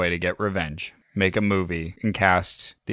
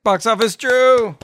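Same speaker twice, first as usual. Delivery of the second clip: big drawn-out higher announcer call; announcer hype; 13 semitones higher, 8 dB louder than usual. Acted. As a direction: introduce him like a game-show host. Hyping up the guest's arrival.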